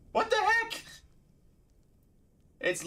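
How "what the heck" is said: squeaky what-the-heck shriek; shocked squeak. Real, not acted. Shocked outburst.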